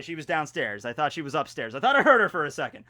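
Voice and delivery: normal talking voice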